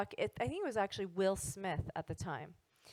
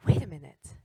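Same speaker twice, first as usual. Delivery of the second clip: sudden realization; 7 semitones lower, 7 dB louder than usual. Retold or imitated.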